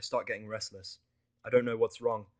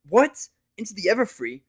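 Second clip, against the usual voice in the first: high, loud spluttering disbelief; shocked exclamation; 10 semitones higher, 13 dB louder than usual. Acted. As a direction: blurt it out in shock. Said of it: "she exclaims in shock".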